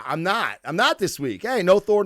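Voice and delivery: ordinary talk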